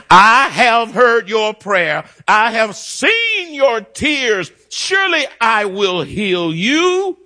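high, slow divine proclamation; voice of the Lord. Acted. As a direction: proclaim it as God's promise, drawn out. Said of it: "Thus saith the Lord".

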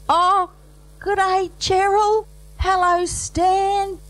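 high, cooing hello to the bride; cried greeting. Acted. Guests greet the bride in a high, cooing cry. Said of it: "they cried as the bride appeared".

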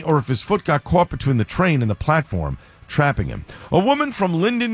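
steady radio newscast delivery; news read. Acted. Reads the headline news.

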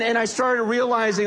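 ordinary talk

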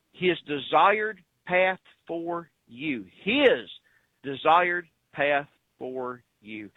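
word by word, very slowly; measured out with pauses to sink in; slow emphasis. Real, not acted. Preacher driving a point home to listeners, slow and deliberate. Spelling out God's will slowly.

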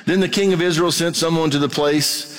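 preacher reading Scripture aloud to his church. Acted. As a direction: read the passage out to the congregation. Reads scripture passage aloud.

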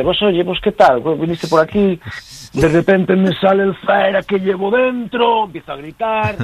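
high, singsong Galician impression; hammed-up Galician, pitched well up; Galician accent. Shown as a demonstration. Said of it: "amigos míos gallegos".

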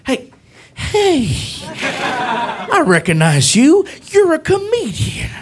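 slow drawn-out cop with high swoops; imitated cop. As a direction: stretch each word in delighted recognition. Acts cop recognizing him.

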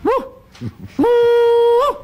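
high loud whooping call; mating call again. Retold or imitated. Hunter retells repeating the call, loud high whoops. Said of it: "So I did it again".

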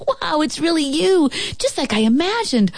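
high, fast awe; thrilled. A narrator's reading. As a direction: gush with wonder. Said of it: "Joey exclaimed".

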